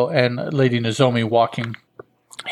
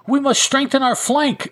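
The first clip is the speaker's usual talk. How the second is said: yelling; 13 semitones higher than usual. Acted. Lady Nozomi yells orders to the troops, high and urgent. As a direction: shout the order over the noise. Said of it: "she yells as they go running".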